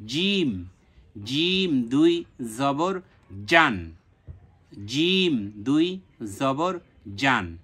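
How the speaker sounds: slow drill with a firm, hard জিম; teacher spelling out জিম দুই জবর জান, hard j; hard jim. Shown as a demonstration. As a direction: press the j of জিম hard, stretching each word. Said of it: "এবং শক্ত করে".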